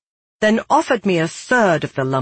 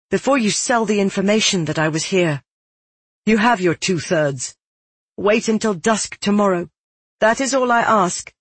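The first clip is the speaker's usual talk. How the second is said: raised, pressing tone; insistent request; 6 semitones higher than usual. Acted. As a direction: press firmly for one day's grace. Girl bargains for a day.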